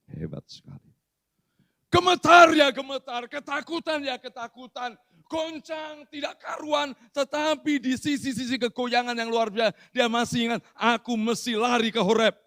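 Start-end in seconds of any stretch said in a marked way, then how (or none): 1.9-12.4 loud, high, fervent preaching; preaching at full force. Acted. Pastor preaching to the church, loud and fervent. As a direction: hammer the fear home to the congregation.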